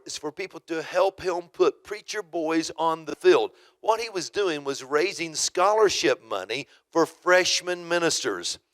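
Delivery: normal talking voice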